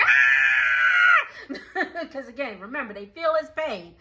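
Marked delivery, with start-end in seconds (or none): 0.0-1.5 a short laughing imitated vampire shriek; mock pained scream, louder. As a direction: screech like a hurt vampire, half laughing. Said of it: "all the vampires are screaming like".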